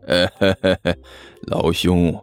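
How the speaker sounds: low, chuckling con-man monk; low-voiced chummy rogue; oily monk. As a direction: sidle in with a low chuckle, buddy to buddy. Monk sweet-talks a rival.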